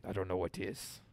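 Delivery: low, soft put-on Russian accent. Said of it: "that's not a Russian stereotype".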